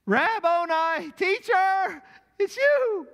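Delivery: very high, loud, drawn-out shout of recognition; acted ecstatic outburst; overjoyed cry. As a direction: cry out as if jumping out of your skin. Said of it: "she came out of her skin".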